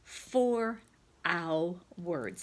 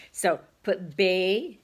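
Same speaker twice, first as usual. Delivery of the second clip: slowly and louder, so put bay; slow louder dictation; 2 semitones higher, 7 dB louder, much slower than usual. Shown as a demonstration. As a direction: dictate bay for the kids to write. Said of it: "slow dictation".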